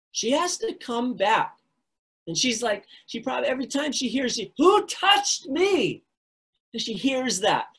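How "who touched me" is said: high, slow, loud call; the healer calling after the fleeing woman; distant cry. Acted. The cry she hears.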